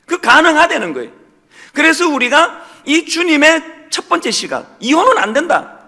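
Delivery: loud, high, emphatic sermon delivery; forceful preaching. Acted. Pastor urging the congregation to declare their faith, forceful preaching. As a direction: preach it out with full conviction.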